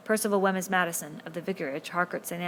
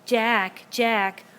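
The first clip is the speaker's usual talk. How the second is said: mocking, louder echo of the call; bitter mimicry; 5 semitones higher, 8 dB louder than usual. A narrator's reading. Jack mocks Ralph's call, bitter louder mimicry. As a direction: parrot him back with spite. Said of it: "sounded in bitter mimicry".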